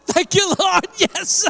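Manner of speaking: high, bright burst of relief; relieved thanks. Retold or imitated. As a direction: let the thanks fly up with relief. Relieved after dismissal by judge.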